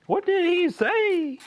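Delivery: very high, loud, drawn-out squeal; squeaky mock question. Streamer clowning over what was said, squeaky mock question. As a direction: ask it in a silly, sky-high voice. Playful echo of a missed remark.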